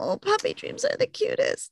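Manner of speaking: soft, high coo over a puppy. Real, not acted. Cooing to co-host about a dreaming puppy, cooing.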